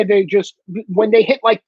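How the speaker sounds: usual speaking voice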